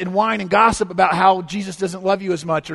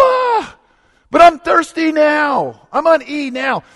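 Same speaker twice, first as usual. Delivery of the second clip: high, loud slow whine; a child begging for more; whining; 9 semitones higher, 7 dB louder, slower than usual. Retold or imitated. Imitating whiny believer.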